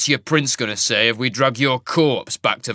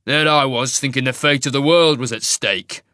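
rough snarl; 2 semitones higher, 7 dB louder than usual. Acted. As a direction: snarl at them impatiently. Jarek sneers on.

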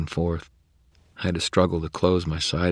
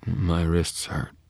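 mumbled; much slower than usual. Acted. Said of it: "Mickey mumbled".

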